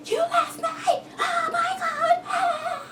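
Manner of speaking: shrieking very high, almost crying; high wailing cry of betrayal; hysterical scream. Retold or imitated. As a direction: scream it like you've been abandoned. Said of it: "While all this screaming is happening".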